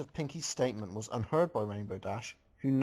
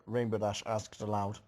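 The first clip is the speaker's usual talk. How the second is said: ordinary delivery, nothing marked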